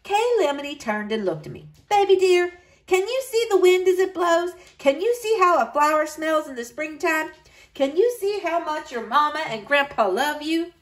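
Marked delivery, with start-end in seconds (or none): 1.9-10.7 higher, tender questions; gentle grandmother. A narrator's reading. Grandmother comforting the crying girl, tender.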